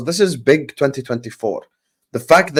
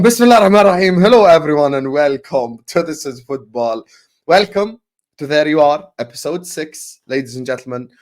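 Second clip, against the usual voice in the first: show intro; 3 semitones higher, 4 dB louder than usual. Acted. Opens the show properly.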